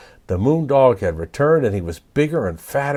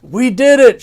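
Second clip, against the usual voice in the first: high, loud cheering shout; triumphant shout; 12 semitones higher, 9 dB louder than usual. Acted. Creatures celebrating scaring off the moon dog, loud high cheer. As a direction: cheer the victory. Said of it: "shouted the night creatures".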